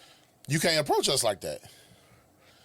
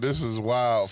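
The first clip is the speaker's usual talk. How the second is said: normal talking voice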